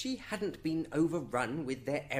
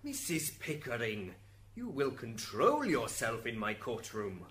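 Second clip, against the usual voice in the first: low, controlled authority; stern reprimand; 7 semitones lower than usual. Acted. Saint Peter rebuking the wife in his courtroom, stern and low. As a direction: lower the pitch and reprimand firmly. Judge reprimands her after outburst.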